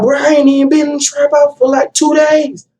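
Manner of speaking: high, disbelieving voice; shocked friend. Retold or imitated. Friend reacting on the phone, high disbelief. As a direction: sound thrown by the news. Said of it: "He like".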